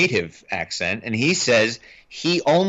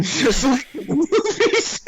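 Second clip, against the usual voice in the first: high, breaking into real laughter; laughing; slower than usual. Real, not acted. Host teasing a dozing co-host who whistles when he snores, laughing. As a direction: laugh helplessly through the words. Laughing at the sleeping co-host's snoring.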